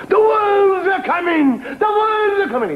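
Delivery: very high, slow wailing alarm; panicked cry. Acted. Villagers warn everyone at the inn, high panicked wail. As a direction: wail the warning like the wolves are at the door. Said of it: "and they go".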